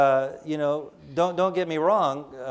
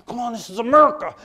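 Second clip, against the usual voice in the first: higher, louder, fast exasperated complaint; frustrated outburst; 9 semitones higher, 8 dB louder, much faster than usual. Retold or imitated. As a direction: snap it out in mock frustration. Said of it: "I go".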